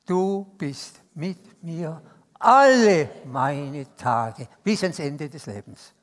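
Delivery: slow emphatic group affirmation; slow underlined recitation. Shown as a demonstration. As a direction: drag out every word for emphasis. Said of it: "unterstreichen wir das alle".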